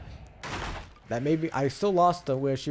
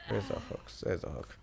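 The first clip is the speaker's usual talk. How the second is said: whisper; 3 semitones lower, 8 dB quieter than usual. Real, not acted. Muttering while looking for hooks.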